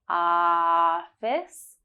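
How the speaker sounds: "Office" with a long held ah. Shown as a demonstration. Teacher stretching the ah in "Office" for learners. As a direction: draw out the first ah of "Office". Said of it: "has the ah sound right at the beginning".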